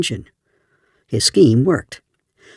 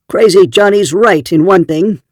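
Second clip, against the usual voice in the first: louder, higher crowd-rousing call; shouting to a crowd; 5 semitones higher, 6 dB louder than usual. A narrator's reading. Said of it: "Jed Harmer shouted to the crowd".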